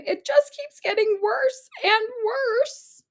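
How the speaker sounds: a mother near tears; tearful whine. A narrator's reading. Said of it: "She began to sob".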